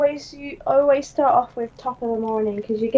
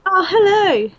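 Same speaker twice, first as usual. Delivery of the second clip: a hint of Geordie, higher and louder greeting; mock Geordie hello, a bit higher; 4 semitones higher, 5 dB louder than usual. Friend greets the Geordie, replies in a put-on Geordie accent. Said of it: "Hello, Geordie".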